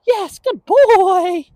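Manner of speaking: drawn-out yes, high praise; praise for dog. Real, not acted. Trainer rewarding dog's stop, slow high praise.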